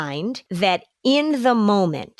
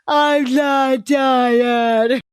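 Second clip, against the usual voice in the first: loud, high, drawn-out mock whine; whining little kid; 6 semitones higher, 5 dB louder, much slower than usual. Little kid denies looking tired to a parent, whining protest. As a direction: stretch every word like an overtired child. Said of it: "like the little kid when you say".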